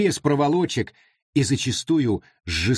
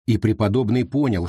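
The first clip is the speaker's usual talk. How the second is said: plain reading voice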